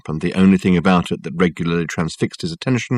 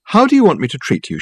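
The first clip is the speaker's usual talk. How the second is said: brisk; 9 semitones higher than usual. Acted. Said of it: "she said, briskly".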